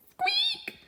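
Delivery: very high, tiny squeak; a thin little squeak; mouse squeak. Acted. Mouse answering in a tiny squeak.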